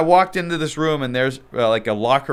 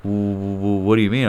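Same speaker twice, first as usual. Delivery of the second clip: stammered quote; 5 semitones lower than usual. Retold or imitated. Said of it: "And he's like".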